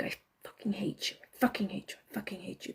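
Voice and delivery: hissed through clenched teeth, barely voiced; clenched-teeth whisper. Shown as a demonstration. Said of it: "clenching my teeth and just, like, saying things like this".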